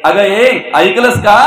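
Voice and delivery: calling out. Acted. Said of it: "आवाज देतात".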